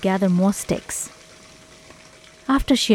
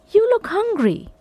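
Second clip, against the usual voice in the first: put-on high girl voice; 12 semitones higher than usual. A narrator's reading. Sasha pities the dog.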